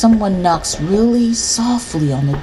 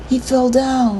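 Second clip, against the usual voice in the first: higher-pitched, innocent excuse; boy's excuse; 7 semitones higher than usual. A narrator's reading. Older brother explains the injury to Mom, making excuses. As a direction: offer the excuse in a lightly boyish voice.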